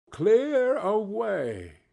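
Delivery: slow, stretched, relishing narration; drawn-out echo. A narrator's reading. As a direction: savor the phrase slowly.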